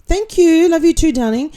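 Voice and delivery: high, loud, affectionate singsong; gushing thanks. Real, not acted.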